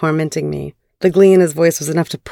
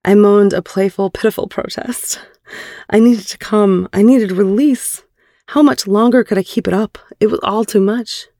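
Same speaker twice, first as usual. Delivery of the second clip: higher, pleading narrator; strained story narration; 4 semitones higher than usual. A narrator's reading. Narrator recounting her playful, pitiful protest, strained and higher. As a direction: let the heroine's desperation lift the narration.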